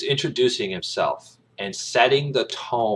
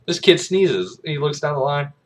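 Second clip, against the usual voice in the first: ordinary talk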